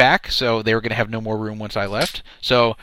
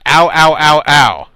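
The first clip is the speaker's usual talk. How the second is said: loud imitated cries of pain, one after another; repeated "ow" yelps, punched out loudly; mock pained yelling; 2 semitones higher, 9 dB louder, slower than usual. Host imitating what the caller overheard through the fight, mock pained yelps. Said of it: "I hear a lot of yelling".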